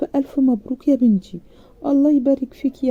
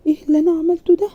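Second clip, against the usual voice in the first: panicked self-reproach; 8 semitones higher, 4 dB louder, much faster than usual. A narrator's reading. Bride panics after slamming door.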